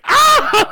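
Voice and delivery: mock panicked scream, very high and loud with a laugh; Justice Smith's scream. Imitating the actor's scream while laughing about the movie. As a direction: squeal it like a terrified guy. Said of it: "like, his scream".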